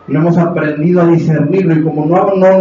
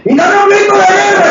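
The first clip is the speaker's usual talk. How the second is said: very high, loud imitation of a concert leader; hammed-up shout to rouse a crowd; mock war-cry call; 5 dB louder, faster than usual. Preacher mocks concert leaders calling for war cries, high shout. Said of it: "Los gritos de guerra".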